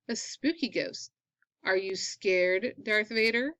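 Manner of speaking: ordinary delivery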